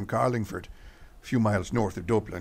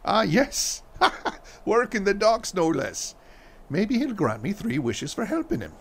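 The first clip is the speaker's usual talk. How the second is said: laughing; 10 semitones higher than usual. Acted. Husband joking about the leprechaun.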